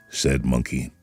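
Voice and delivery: ordinary delivery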